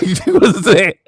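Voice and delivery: ordinary talk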